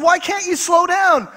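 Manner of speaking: high, stretched incredulous question; mock baffled. Husband questioning wife's refusal, mock baffled. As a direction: push the pitch up in mock disbelief. Husband challenging wife in retold quarrel.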